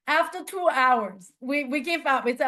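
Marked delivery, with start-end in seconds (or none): none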